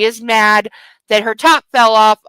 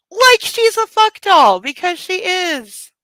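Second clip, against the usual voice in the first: pitched way up, slow and sneering; mock the man's view with a high, drawn-out sneer; sarcastic mockery; 9 semitones higher, 3 dB louder, slower than usual. Real, not acted. Reader sneering at the hero's attitude, high and slow.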